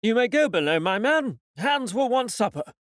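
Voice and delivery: a captain's firm command to his man; stern captain command. Acted. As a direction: dismiss the cook with authority. Captain orders the cook.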